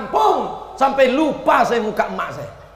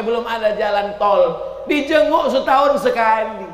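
usual speaking voice

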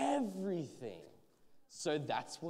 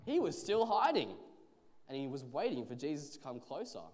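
usual speaking voice

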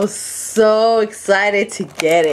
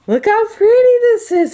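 high, louder-than-usual gushing; delighted squeal; 4 dB louder than usual. Real, not acted. Crafter showing off the blushing pink, delighted squeal.

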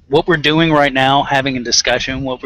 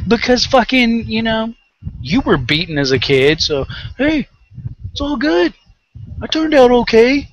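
high, put-on voice of a smug defender; mocking impression; 7 semitones higher than usual. Mimicking parents who shrug off beatings, high mocking voice. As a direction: parody their shrugging excuse.

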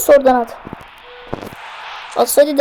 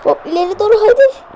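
excited urging; 8 semitones higher, 3 dB louder than usual. Real, not acted. Urging players forward in game.

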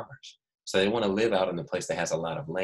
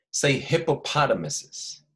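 slow syllable by syllable; 6 semitones higher, slower than usual. Shown as a demonstration. Teacher to class, slow drawn-out modeling. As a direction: slowly spell out each syllable. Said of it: "That's a big word, so we're gonna say it again".

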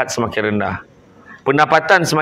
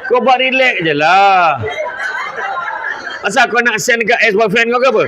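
real laughing jab at the listener; playful teasing; 8 semitones higher than usual. Real, not acted. Teasing audience member recording.

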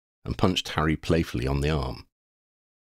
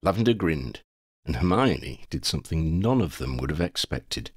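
ordinary delivery

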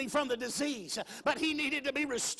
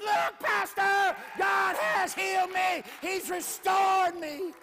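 much higher, louder, slow exultant cry; joyful outburst; 6 semitones higher, 4 dB louder, much slower than usual. Retold or imitated. Preacher voicing the healed woman telling the pastor, high joyful shout. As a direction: proclaim the healing in triumph.

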